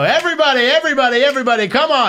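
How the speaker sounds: high, sing-out crowd call; high-pitched rallying call. Retold or imitated. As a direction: holler to round up a lobby. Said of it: "you're just yelling every".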